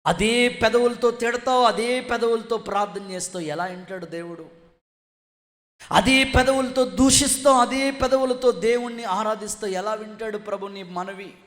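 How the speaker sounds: loud, high accusations, easing on each question; forceful preaching rebuke. Real, not acted. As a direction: rebuke the congregation with rhythmic repetition. (ఆవేశంగా గద్దిస్తూ ప్రసంగం)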